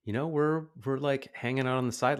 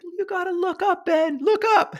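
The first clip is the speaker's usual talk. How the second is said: much higher and louder call across the path; 8 dB louder than usual. Retold or imitated. Men call out to Ben riding down gravel path, loud high call. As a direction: shout encouragement to a wobbling kid. Calling out to kid on bike.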